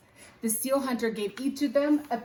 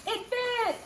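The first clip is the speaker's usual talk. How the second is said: triumphant fit. Acted. Relief the pack fits.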